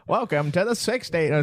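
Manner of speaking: put-on high old-time radio announcer voice; lightly voiced vintage announcer; old-timey announcer. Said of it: "When is this voice?".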